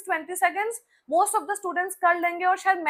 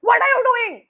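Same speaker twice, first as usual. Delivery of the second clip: louder, higher snapping question; scolding shout; 9 semitones higher, 9 dB louder, much slower than usual. Shown as a demonstration. Teacher shouting at a misbehaving student. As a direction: bark it at a misbehaving kid. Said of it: "instead of saying".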